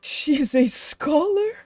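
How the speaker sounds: high, slow, incredulous question; can't believe it, stretch it out; stunned, high and drawn-out; disbelief. Real, not acted. Disbelief at character's job.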